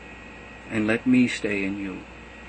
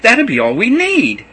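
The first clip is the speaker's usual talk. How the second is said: much higher and louder plea; eager demand; 11 semitones higher, 10 dB louder than usual. A narrator's reading. Philip demanding to see the Father, high and loud. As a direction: insist on seeing the Father.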